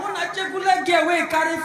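ordinary delivery

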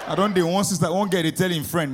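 normal talking voice

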